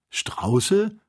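high, scoffing question; mocking. A narrator's reading. Joe mocking the hunter's ostriches, scornful. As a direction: scoff at the word. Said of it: "höhnte Joe".

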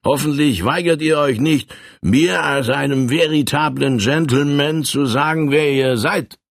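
a hint of a boastful frontier bully; brash colonel. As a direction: push the drink on him with swagger. Colonel's pushy offer, held voice.

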